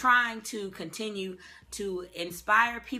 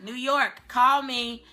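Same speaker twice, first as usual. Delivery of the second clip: louder and higher, a bright shout-out; calling out; 7 semitones higher, 10 dB louder, much slower than usual. Real, not acted. An actress calling out to New York for work, loud and bright. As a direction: call out to the whole city.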